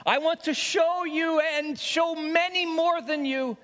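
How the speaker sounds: high, pressing sermon voice, building; impassioned preaching. Acted. Preacher laying out his case to God, impassioned and high. Preaching resumes at full pitch.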